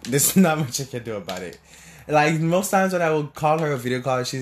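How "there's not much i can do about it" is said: chuckling through the words; laughing. Real, not acted. Son shrugging off his mom's views, laughing. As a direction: laugh it off lightly. Laughing repeat of line 156.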